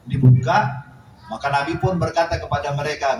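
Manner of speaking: ordinary talk, nothing marked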